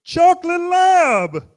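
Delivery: high, loud, slow and flat-ended insistence; goofy man, insisting. Retold or imitated. Stranger repeating the breed to a confused dog owner, slow and high. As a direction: drag it out like he's repeating himself patiently. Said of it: "and goes".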